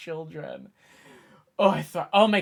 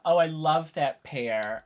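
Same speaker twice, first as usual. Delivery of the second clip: usual speaking voice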